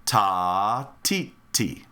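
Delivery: TA held long, each TI short; rhythm syllables. Shown as a demonstration. Teacher modelling a rhythm, ta drawn out, tis short. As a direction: chant a long "ta", then two brief "ti"s. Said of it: "saying the ta longer, and the T's shorter".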